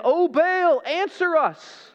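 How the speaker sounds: high, loud, drawn-out cry to Baal; calling out. Acted. Prophets calling on their god, crying out.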